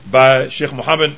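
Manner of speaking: ordinary delivery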